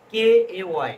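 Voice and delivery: emphatic spelling of the letter K; spelled out clearly. Shown as a demonstration. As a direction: spell it out firmly for the class. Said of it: "এভাবে বলতে হবে".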